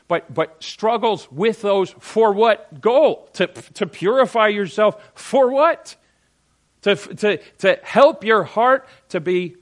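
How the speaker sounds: high, loud, slow and emphatic; impassioned preaching. Real, not acted. Preacher challenging the congregation on why they struggle, impassioned. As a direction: drive each phrase home with force.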